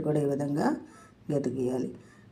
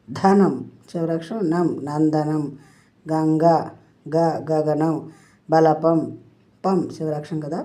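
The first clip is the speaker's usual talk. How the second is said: ordinary talk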